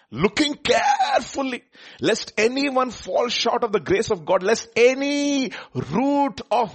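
high, slow, drawn-out preaching read; emphatic scripture reading. Acted. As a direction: stretch out the key words, high and slow. Reads scripture out with great emphasis.

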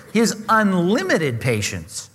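"unlimited" drawn out slow and weighty; slow, emphatic "his unlimited patience"; stress on unlimited. Shown as a demonstration. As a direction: lean on "unlimited" and slow the whole phrase right down. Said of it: "underline this word".

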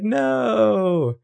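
high, drawn-out mock cry; mock wailing no. Spencer as A runs off, mock wailing no. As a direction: wail it long and high, hammed-up.

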